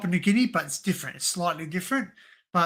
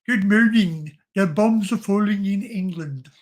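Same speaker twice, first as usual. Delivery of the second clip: loud, raised-pitch comic impression; Mister Crabtree; 4 semitones higher, 11 dB louder than usual. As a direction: put on a loud, higher character voice. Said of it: "Mister Crabtree".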